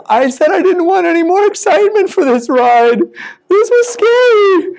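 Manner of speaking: high, slowed, shaken wife's voice. Acted. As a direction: drag out the scare, voice high. Mary scolds after near crash.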